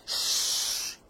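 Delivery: long voiceless shush; hushing. Acted. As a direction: cut the outburst off with a drawn-out hiss. Hushes the angry brain. (long chut soufflé)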